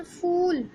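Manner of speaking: फूल with a long stretched ऊ; long oo in phool. Shown as a demonstration. Teacher to class, showing the long ऊ in फूल. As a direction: say फूल holding the long ऊ sound with effort. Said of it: "ज़ोर लगाना पड़ रहा है ना मुझे बोलने में".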